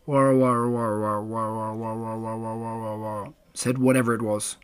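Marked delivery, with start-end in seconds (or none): 0.1-3.3 low, droning "war" on one note; strange animal noise. A narrator's reading. Mysterious animal outside Pooh's door at night, low droning call. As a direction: drone on and on, fading softer. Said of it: "But the noise went on".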